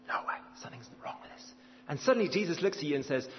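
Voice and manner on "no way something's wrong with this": fast hushed whisper under the breath; whispered thought. Pretend. A scribe whispering his suspicion of Jesus. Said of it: "And you're thinking".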